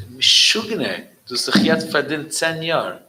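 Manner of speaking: scolding a madman; astonished outburst. Retold or imitated. Exclaims at the Rebbe.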